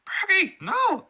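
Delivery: very high, loud cry of horror; desperate shout. Acted. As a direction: scream out to a comrade in danger. Acted desperate scream to comrade.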